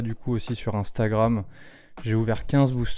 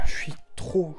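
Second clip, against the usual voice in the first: trembling; 4 semitones higher, slower than usual. Real, not acted. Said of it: "je tremble de partout".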